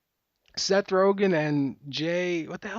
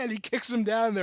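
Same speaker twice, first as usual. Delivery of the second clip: normal talking voice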